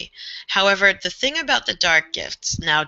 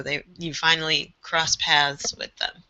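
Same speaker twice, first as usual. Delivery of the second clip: usual speaking voice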